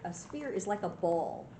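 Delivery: plain reading voice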